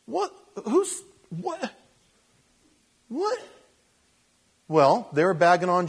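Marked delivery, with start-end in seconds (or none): 0.1-3.4 mock bewildered.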